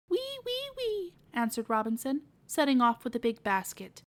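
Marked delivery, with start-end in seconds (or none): 0.1-1.1 high squeaky piglet voice; little pig squeal. Acted. Piglet squeals his little song.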